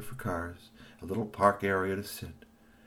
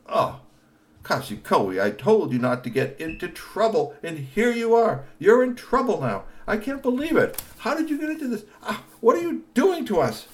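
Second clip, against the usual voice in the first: high, loud exasperated scold; scolding uncle; 14 semitones higher, 7 dB louder than usual. Acted. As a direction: tell him off, exasperated.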